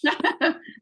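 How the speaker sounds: a bright little laugh; short laugh. Real, not acted. Host laughing after Adam reads the line aloud. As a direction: laugh warmly, briefly.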